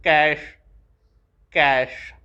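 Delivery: Cash said loud and clear, sh ending; emphatic model word. Shown as a demonstration. Teacher modelling the word for young learners. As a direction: call out Cash for the class to copy. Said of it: "It will sound as sh".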